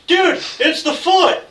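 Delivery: high, slow mock-weary "dude"; bored turtle voice. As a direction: drawl it out like a fed-up turtle. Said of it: "It's like".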